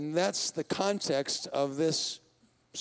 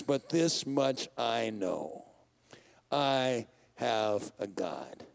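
much lower, very slow, quiet trust; slow, soft declaration; 7 semitones lower, 4 dB quieter, much slower than usual. Acted. Preacher re-reads the psalmist's vow slowly and softly. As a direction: breathe it out slowly, like a quiet confession. Psalm line re-read, slowed and softened.

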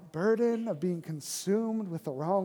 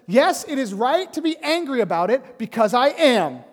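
indignant shout; 6 semitones higher, 7 dB louder than usual. Retold or imitated. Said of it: "And Jonah says".

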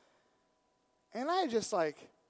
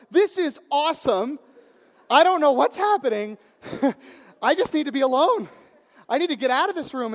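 loud, high, breathless wonder with a laugh; overwhelmed; 8 semitones higher, 16 dB louder than usual. Retold or imitated. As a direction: swept away, needing to escape. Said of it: "I just got overwhelmed with this".

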